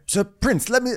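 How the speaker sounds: high-pitched imitation. Sir Harold quoted peering at Aegon.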